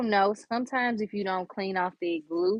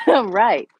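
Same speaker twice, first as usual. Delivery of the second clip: laughing; 4 semitones higher, 7 dB louder than usual. Real, not acted. Laughing over own hiccups.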